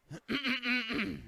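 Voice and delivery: quick, rough throat clear. Real, not acted. Broadcaster excusing himself to the audience, throat clearing. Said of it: "pardon me".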